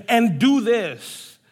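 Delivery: And do this, slowed with stress on do; slow emphasis. Shown as a demonstration. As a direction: lean on do, slowly. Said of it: "focus on verse eleven".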